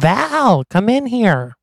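high-pitched call, drawn out on the name; mock scream. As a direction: yell for someone to come, without full volume.

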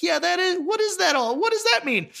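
high, puzzled crowd mutterings; confused crowd. Retold or imitated. Crowd doubting the heroes, high confused voice. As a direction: ask it like bewildered onlookers.

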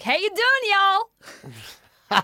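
loud, high mock American accent. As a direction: holler it like a hammed-up American. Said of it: "I come back from America".

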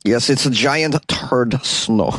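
slow, heavy mock Russian accent; hammy fake Russian accent. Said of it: "this terrible Russian accent".

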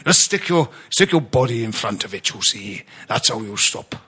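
a mild Welsh footballer voice; Welsh accent. Retold or imitated. Doing James Collins for his podcast mates in a light Welsh accent. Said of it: "I can only really do a Welsh accent if I put the words "you see".

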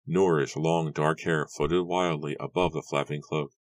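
usual speaking voice

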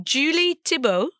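"Thibault" said the French way, silent ending; French pronunciation. Shown as a demonstration. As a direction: give "Julie Thibault" your best French pronunciation. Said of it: "My best French pronunciation".

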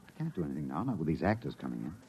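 husband answering his wife quietly; hushed reply. Acted. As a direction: keep it down.

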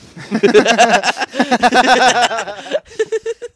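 loud laugh. Real, not acted. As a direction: burst of high, loud laughter. Big laugh at own story.

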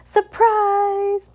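high, drawn-out "surprise" call; party shout. Acted. Guests greeting Bruce at the door, shouting. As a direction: sing it out like a roomful of guests. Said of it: "the guest shouted".

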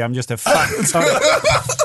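mock confession. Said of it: "You just go".

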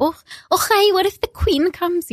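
high fussy voice fretting about the queen; mock posh mother. Mocking his prim mother, high and fussy. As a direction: fret like a proper hostess. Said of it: "like, little s-, cup with a little saucer".

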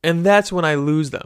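usual speaking voice

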